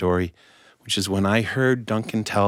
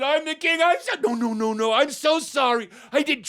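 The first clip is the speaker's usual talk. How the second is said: panicked apology. Acted. Beggar apologizes frantically to king.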